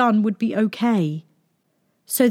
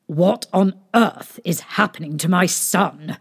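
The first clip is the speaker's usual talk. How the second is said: plain reading voice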